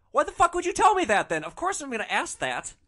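high, loud indignant retort; exasperated outburst. Retold or imitated. Driver reacts to passenger's coy answer, exasperated. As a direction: burst out in mock exasperation.

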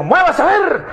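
wife shouting at her husband to cook; yell. Retold or imitated. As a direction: bark the command. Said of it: "le pegó un grito".

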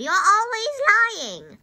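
high, drawn-out accusing yell. A narrator's reading. Grandma accuses George of lying, yelling. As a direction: yell it high, long and loud. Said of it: "Grandma yelled".

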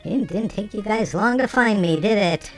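ordinary delivery

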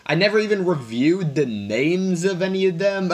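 ordinary delivery

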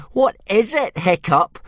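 high, loud jeering bully voice; a sneering Viking boy taunting Hiccup; mocking bully. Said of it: "said Snotlout".

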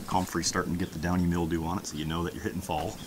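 usual speaking voice

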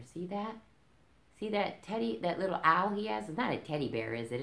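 plain reading voice

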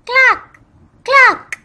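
very high, loud clucking; hen cluck. Shown as a demonstration. Teacher showing kids a hen, loud high clucking. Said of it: "A hen says".

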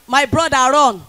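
ordinary talk

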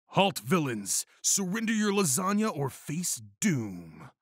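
high-pitched, mock-heroic roar; fiery rage. A narrator's reading. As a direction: challenge the villains, pitch climbing into hammed-up rage. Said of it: "roared Garfield with fiery rage".

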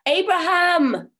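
high, loud, slow call. Acted. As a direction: shout it across the bog. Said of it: "she called out to him".

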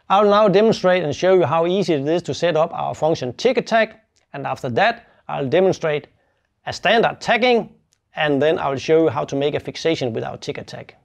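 ordinary talk

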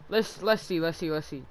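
ordinary talk, nothing marked

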